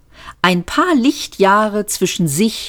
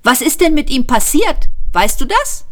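high, urgent questioning; very excited; 9 semitones higher than usual. A narrator's reading. Ford questions the robot about the ship's fate, excitedly. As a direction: press for news in a raised pitch. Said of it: "plötzlich ganz aufgeregt".

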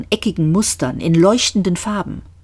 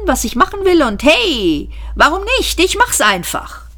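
higher-pitched, animated confession; breezy, muddled space adventurer; 4 semitones higher than usual. Acted. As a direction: explain yourself with swooping, high peaks.

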